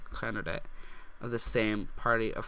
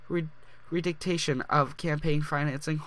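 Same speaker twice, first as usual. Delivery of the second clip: normal talking voice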